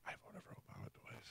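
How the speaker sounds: voiceless whisper. Retold or imitated. Host imagining a confession to the old man, whispered. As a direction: lean in and breathe it secretly. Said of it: "lean in and go".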